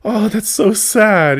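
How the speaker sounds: high, loud, slow token sorrow; mock sympathy. Imitating friend's reaction to his death, mock sad. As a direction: draw it out in exaggerated pity. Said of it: "I think you would be like".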